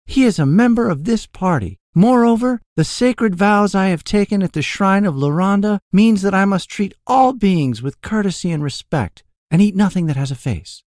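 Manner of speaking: high, loud enchantress voice; intoned with reverence. A narrator's reading. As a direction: intone sacred vows grandly, pitched high. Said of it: "she intoned with reverence".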